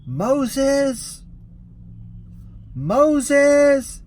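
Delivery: loud high call across a courtyard; shouting Moses, high and loud; yelling a name. Retold or imitated. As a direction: call out for a missing passenger at full voice. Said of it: "I start yelling out".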